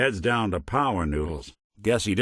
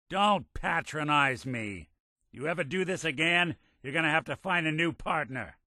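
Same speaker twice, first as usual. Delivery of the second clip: stern warning; 6 semitones higher, 5 dB quieter than usual. Acted. Warns partner not to repeat it.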